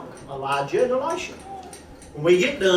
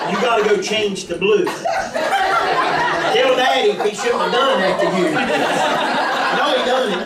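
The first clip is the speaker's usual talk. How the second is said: talking through laughter, a little louder; laughing; 3 dB louder, slower than usual. Real, not acted. Speaks to the interrupting child.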